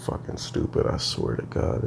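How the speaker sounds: hushed frustrated whisper. Real, not acted. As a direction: breathe it out under your breath. Muttered frustration at online school.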